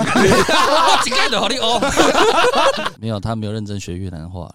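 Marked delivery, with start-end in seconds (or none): 0.0-3.0 high, loud, slow laughing threat; mock-threaten a friend through laughter; laughing tease. Real, not acted. Teasing his friend about partying, loud laughing.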